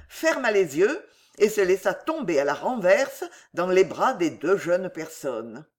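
ordinary delivery

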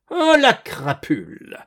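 high, loud indignation; outraged cry. A narrator's reading. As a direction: shout furiously after the culprit. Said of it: "cria le vieux".

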